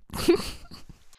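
short breathy giggle. Real, not acted. Solo podcaster giggling at her own rambling. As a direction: laugh at yourself. Laughing at herself.

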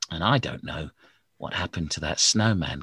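ordinary delivery

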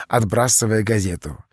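plain reading voice

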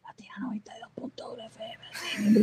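whispered station ID through a laugh; whispering. Retold or imitated. Host imitating how his too-quiet mic sounded, laughing whisper. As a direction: breathe it out in a giggly whisper. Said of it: "Está susurrando".

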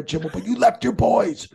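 mock reproach. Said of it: "just stopped and looked at him".

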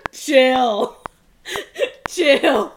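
booming mock-Austrian action villain accent; loud, hammed-up Arnold accent; Arnold Schwarzenegger. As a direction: bellow it as an Arnold imitation. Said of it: "Arnold Schwarzenegger is the".

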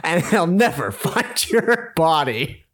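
hammed-up slogan threat, drawn out at the end; dramatic reading. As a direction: declaim it as an over-the-top warning. Reads shirt slogan aloud.

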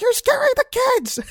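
very high falsetto voice; shrill-voiced hammed-up woman; mock girlfriend. Girlfriend scolding the boyfriend, very high falsetto. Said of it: "She's like".

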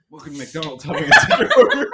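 laughing mock drive-through greeting; fast-food greeter. Joking that the dispatcher greets customers, high and laughing. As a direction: jump high and fast on the order question. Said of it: "she was gonna wipe her tears and go".

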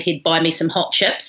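ordinary talk

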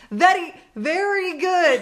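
slow, loud, high mock Russian accent, caught out; Russian accent faltering. Host mimics friend catching herself before a Russian, accent breaking off. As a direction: stretch it out, sliding out of a fake Russian accent. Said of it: "realized partway through that the guy was actually Russian".

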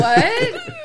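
high, loud, startled "what"; retold shock. Wife reacting to news of a cake, shocked. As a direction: burst out in mock disbelief.

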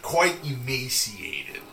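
"quite emaciated" at half speed; drawn-out delivery. Acted. Game master describing the starved captive, drawn-out delivery. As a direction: stretch out both words of "quite emaciated".

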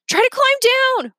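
high, loud urging yell. A narrator's reading. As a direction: call up to him in panic. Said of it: "Remy yelled".

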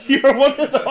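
laughing through the reveal. Real, not acted. Telling a fan he's one of them, laughing.

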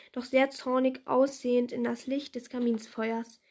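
ordinary delivery, nothing marked

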